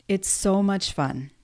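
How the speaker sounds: slow, drawn-out dry sarcasm; sarcastic. Real, not acted. Vlogger joking about perimenopause acne, sarcastic. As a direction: lay on mock enthusiasm, taking your time. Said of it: "that was complete sarcasm".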